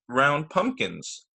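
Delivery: ordinary delivery, nothing marked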